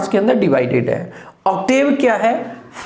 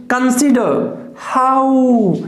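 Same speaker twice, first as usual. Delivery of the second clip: Consider हाउ said very slowly, higher; iambic stress; 4 semitones higher, much slower than usual. Shown as a demonstration. Teacher sounding out iambic beats to the class. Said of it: "ये unstressed हो जाएगा".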